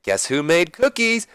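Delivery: slow, high sing-out question; Deborah. Acted. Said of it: "and Deborah's like".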